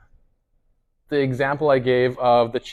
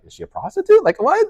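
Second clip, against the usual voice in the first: high, startled retold question; confused surprise; 5 dB louder than usual. Reading the Facebook post, baffled high surprise. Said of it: "I was confused".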